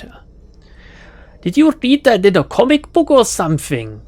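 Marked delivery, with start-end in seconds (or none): 1.4-4.0 put-on higher-pitched, scornful German accent; frustrated German accent. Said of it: "shakes her head in frustration".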